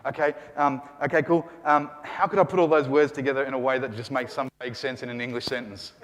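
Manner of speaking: a lightly voiced clueless student, quick and soft; mocking how students muddle through. Teacher mimicking students' wrong approach, lightly mocking. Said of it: "you are not gonna look at the sentence and go".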